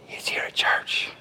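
hushed whisper, telling a secret. Acted. Pastor confides to the congregation where to find them, whispering. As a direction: lean in and whisper it to the room.

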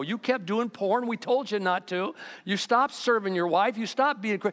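ordinary talk

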